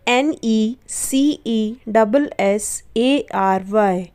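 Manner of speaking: slow, letter by letter spelling; spelling slowly. Shown as a demonstration. Teacher spelling the word slowly for students. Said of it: "इसे हम पढ़ते हैं necessary".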